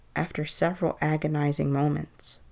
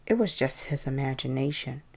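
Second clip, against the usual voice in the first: ordinary delivery, nothing marked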